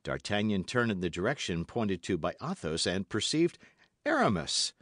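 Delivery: usual speaking voice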